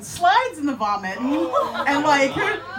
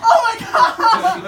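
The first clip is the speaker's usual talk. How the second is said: much higher and louder, words broken by laughter; laughing uncontrollably; 12 semitones higher, 12 dB louder than usual. Retold or imitated. Foreigner alone on a silent train, helpless laughter. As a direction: lose it completely, laughing from the gut. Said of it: "I just could not contain myself".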